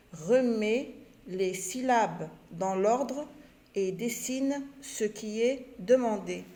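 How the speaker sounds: repeated slowly, word by word; slow repetition. Shown as a demonstration. Teacher repeating the instruction to pupils, very slowly. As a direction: say it again very slowly for the class. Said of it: "Je répète".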